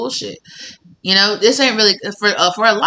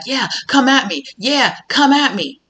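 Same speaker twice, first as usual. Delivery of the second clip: cocky dare; 7 semitones higher than usual. Retold or imitated. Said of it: "some of these people are like".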